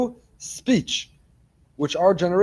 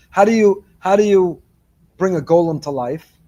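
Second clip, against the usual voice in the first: emphatic loud question; 6 dB louder than usual. Real, not acted. Preacher pressing the big question.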